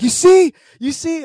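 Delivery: high, loud, insistent "you see"; mock excited yelling. Dad to animal control, pointing at footage, loud and high. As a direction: shove the footage in his face.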